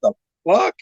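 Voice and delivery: high, sharp shocked "The fuck?"; shocked disbelief. Retold or imitated. Fighter hearing the opponent's weight, high shocked blurt.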